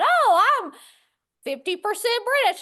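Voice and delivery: eager exclamation over his DNA results; excited discovery. Retold or imitated. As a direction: blurt out the surprising news. Father reads DNA results.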